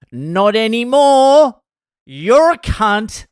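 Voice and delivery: loud, high, drawn-out mock tirade; angry yelling. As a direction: act out a furious walk-out rant, stretching the words. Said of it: "he's yelling at the bar manager".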